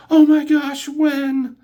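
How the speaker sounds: high excited shriek; squealing fan. Retold or imitated. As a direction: gush like a thrilled fan. Excited fan hearing of show.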